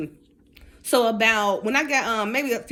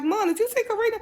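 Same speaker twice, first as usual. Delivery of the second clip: much higher, excited daughter; 10 semitones higher than usual. Retold or imitated. Daughter telling mom about Karina, much higher excited gossip. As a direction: play the teenage daughter, thrilled with gossip. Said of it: "it took my daughter like".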